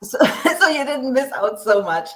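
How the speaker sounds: ordinary talk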